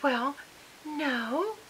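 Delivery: high, soft, syrupy Southern mother; butter-wouldn't-melt Southern innocence; sweet Southern voice. Retold or imitated. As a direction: imitate a sugary Southern lady. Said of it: "so sweet butter wouldn't melt in her mouth Southern voice".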